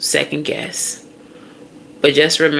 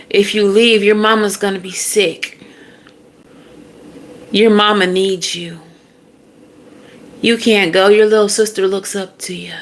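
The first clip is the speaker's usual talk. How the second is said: higher-pitched mock family guilt trip; guilt-tripping plea; 4 semitones higher than usual. Family begging someone not to leave, mock guilt-tripping. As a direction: plead as the relative laying on guilt.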